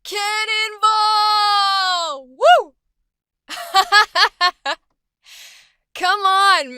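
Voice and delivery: high, loud whooping with joy; pure joy at a pool party; joyful party shout. Acted. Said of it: "we're gonna play with that for happy".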